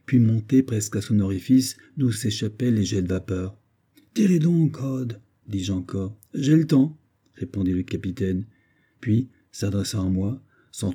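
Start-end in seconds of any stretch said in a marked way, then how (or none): 4.1-5.2 high-pitched, insistent call; urgent urging. Acted. Narrator urges the captain to fire at the leopard, urgent. 6.3-7.0 higher, louder quick reply; assured retort. Acted. Captain answers his anxious companion, assured retort.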